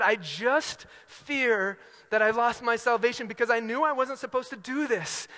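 imitating a distraught young man; anguished confession. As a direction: plead it out in mock-earnest worry. Acting the distressed camper's confession.

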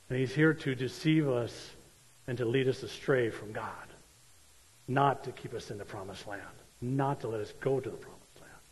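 ordinary talk

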